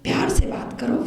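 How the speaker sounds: tender whisper. Real, not acted. Preacher urging listeners to speak lovingly to God, whispered. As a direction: breathe it gently like a secret.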